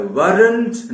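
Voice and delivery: correcting the learner on "weren't"; stressed correction. Shown as a demonstration. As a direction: insist on "weren't" with a firm slow push. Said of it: "weren't होता है".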